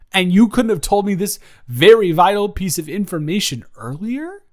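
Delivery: hammed-up indignant rant. Telling off Chelsea for keeping the secret, in a hammed-up furious voice. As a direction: rant like the world just ended over gossip.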